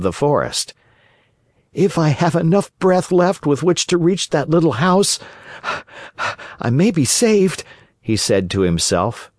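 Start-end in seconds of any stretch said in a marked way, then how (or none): 1.7-7.6 raised, breathless puppet voice; a hunted wooden boy hoping to escape; frightened Pinocchio. A narrator's reading.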